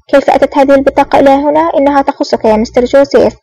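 usual speaking voice

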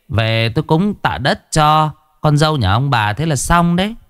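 a self-satisfied fortune-teller declaring the spirits agreed; shaman's voice. A narrator's reading. Shaman assures the grieving couple, smug and high-pitched. Shaman tells family ritual accepted.

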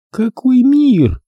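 high, slow mocking woman's question; smirking. Corola mocks the narrator's words. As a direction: sneer it with a smirk. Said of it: "усмехнулась она".

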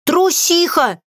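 high, scolding cry; loud shout. A narrator's reading. Witch to her cowardly crow, high scolding shout. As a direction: call out the insult at a high pitch. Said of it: "громко крикнула я".

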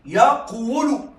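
second يقول drawn out as "yaq-wulu"; slow, split يقول with a heavy wu; heavy root form. Shown as a demonstration. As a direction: close yaq on the q, then a full w in يقول. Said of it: "فيه ثقل على اللسان".

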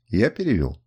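ordinary delivery, nothing marked

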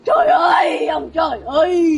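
hammed-up, slow cry to heaven; theatrical sobbing lament; mock wailing. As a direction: wail it low, drawn out and melodramatic. Mock lament after divorce announcement.

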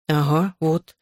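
mild awkward mumble; mumbled. A narrator's reading. Embarrassed boy backs up the girl's story, mumbling. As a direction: mumble it hesitantly. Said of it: "промямлил я".

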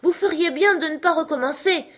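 high-pitched shouted warning; crying out. A narrator's reading. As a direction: call out a stern warning. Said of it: "et elle s'écria". (avertissement crié, voix aiguë)

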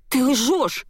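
high, sudden cry of denial; outraged exclamation. A narrator's reading. Heroine defending her father, outraged exclamation. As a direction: burst out accusingly, pitched right up. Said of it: "воскликнула я".

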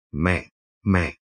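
hushed, pained calling of mother; soft choked call. Acted. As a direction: say it low and softly, holding back tears. Son greets grieving mother.

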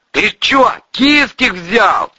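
loud, high, drawn-out holler; slow sentry's call. Acted. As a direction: shout the question from above. Sentry calls down to Valery.

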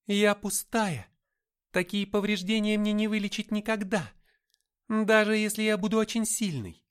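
high, loud helpless protest; pleading. A narrator's reading. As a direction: plead that you are powerless, pitched high. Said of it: "взмолилась Габриэль".

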